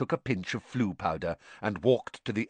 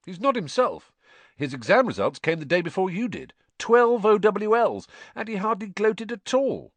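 higher, lighter teen twin voice mocking his brother; higher cheeky schoolboy twin voice; Fred, teasing; 11 semitones higher, 5 dB louder than usual. A narrator's reading. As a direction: play a cheeky twin poking fun.

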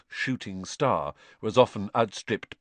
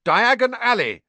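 louder, much higher shout; 9 dB louder than usual. A narrator's reading. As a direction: call the destination into the flames.

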